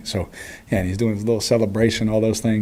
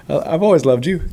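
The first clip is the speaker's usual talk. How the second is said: mock protest; 7 semitones higher, 4 dB louder than usual. Joking reply to adoring fans.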